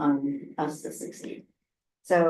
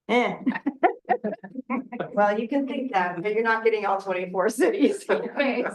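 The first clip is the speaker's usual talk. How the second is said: chuckling through the words; laughing; 5 semitones higher than usual. Real, not acted. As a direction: laugh as you say it. Joking aside after laughing.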